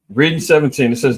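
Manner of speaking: ordinary talk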